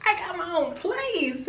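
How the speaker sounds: high, soft delighted squeal; giddy high-pitched joy; excited. Retold or imitated. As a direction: gush about your own place. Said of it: "I'm just like".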